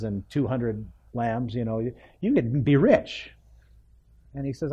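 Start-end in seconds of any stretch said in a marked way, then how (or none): none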